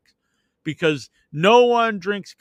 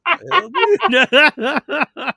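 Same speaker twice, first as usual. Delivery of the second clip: very slow, high, loud disbelief; stretched-out what the hell; 10 semitones higher, 6 dB louder, much slower than usual. Retold or imitated. At the old driver honking at 1:30 am, a long high loud what the hell. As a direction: drag it out in shock, high and loud. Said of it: "I was like".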